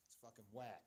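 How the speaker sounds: quietly grumbled under breath; muttered. Real, not acted. Muttered complaint, then repeated louder.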